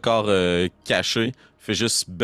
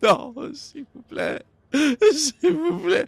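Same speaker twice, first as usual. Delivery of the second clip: high-pitched wail, slow drawn-out begging; screaming plea; 3 dB louder, much slower than usual. Acted. Bandit in front after watching his companion die, screaming plea. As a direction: beg in terror, stretching the words out high. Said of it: "puis l'autre qui est en avant".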